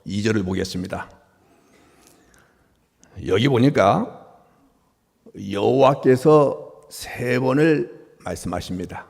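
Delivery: normal talking voice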